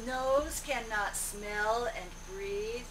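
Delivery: ordinary talk, nothing marked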